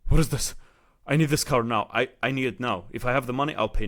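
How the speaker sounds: loud, high, urgent demand for the car; eager buyer almost shouting; excited rush. Retold or imitated. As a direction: blurt it out like you can't wait. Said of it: "I'm shaking".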